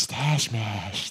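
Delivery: slow, lazy stoner drawl on stash mash. Retold or imitated. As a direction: savour the words like a stoned friend. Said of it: "That was how he, he said it".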